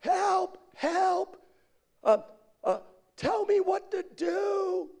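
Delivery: high, drawn-out wail for help; hammed-up cry of a stranded man; desperate plea. Man on the cliff begging directions, high desperate call.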